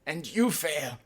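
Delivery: high put-on professor's comeback; smiling mock-academic taunt; smiling retort.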